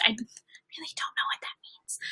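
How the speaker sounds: hushed, whispered confession; whispered aside. Real, not acted. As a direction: drop to a whisper as if confiding. Confiding aside to viewers.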